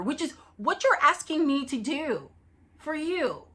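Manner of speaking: wounded protest. Acted. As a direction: throw the demand back at the husband, hurt. Wife protesting the divorce.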